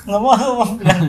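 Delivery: put-on sulky toddler refusing to go; whiny child voice. Father voices a tired child.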